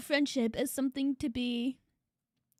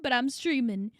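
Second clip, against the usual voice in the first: usual speaking voice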